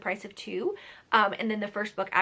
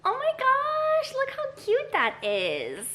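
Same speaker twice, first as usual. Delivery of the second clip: very high, slow squeal of delight; drawn-out high "oh my gosh"; delighted gush; 3 dB louder, slower than usual. Real, not acted. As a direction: gush over something adorable. Sees her cute camera angle.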